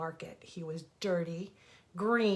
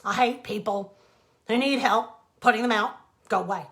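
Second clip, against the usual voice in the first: louder, curt dismissal; snapping; 9 dB louder than usual. Acted. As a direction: shoo the rabbit off. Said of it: "snapped the Grackle".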